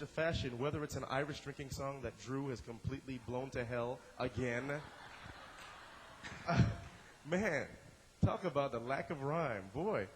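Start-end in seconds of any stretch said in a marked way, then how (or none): 4.7-6.4 soft, breathy chuckle; amused wheeze of a laugh. Real, not acted. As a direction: laugh quietly at the memory. Recalls a friend's blown song.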